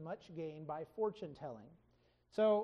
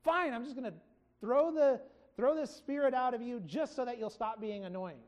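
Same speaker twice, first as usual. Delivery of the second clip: exasperated mock outburst, higher and louder at first; acted annoyance; 3 semitones higher, 4 dB louder than usual. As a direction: give in crossly, imitating someone fed up. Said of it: "Paul's just really annoyed, and he's like".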